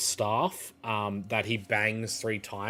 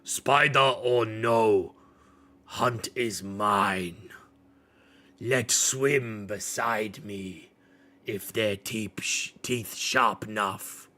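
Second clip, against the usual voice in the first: slow, lightly voiced blunt beast; Torgal, terse hunter; 4 dB louder, slower than usual. Torgal claims the hunt, slow and terse, lightly voiced. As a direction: grunt out the claim. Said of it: "Torgal finally speaks up".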